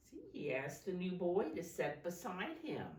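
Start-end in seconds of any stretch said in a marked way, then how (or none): none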